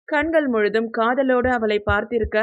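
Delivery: plain reading voice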